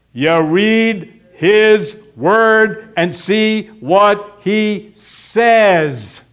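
very slow, word by word; emphatic spelling-out. Real, not acted. Preacher answers how to know God's will, emphatically slow. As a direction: space out every word, loud and firm.

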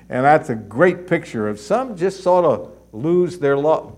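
ordinary talk, nothing marked

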